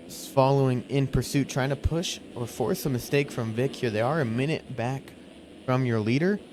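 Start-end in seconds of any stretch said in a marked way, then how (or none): none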